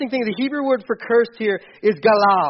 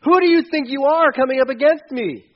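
mocking Goliath taunt; 7 semitones higher, 3 dB louder than usual. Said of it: "He's like".

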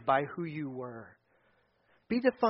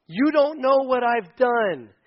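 loud, higher and very slow protest; pleading sinner; 6 semitones higher, 6 dB louder, much slower than usual. Retold or imitated. Believer protesting to Jesus, loud and slow. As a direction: plead that your past is too much.

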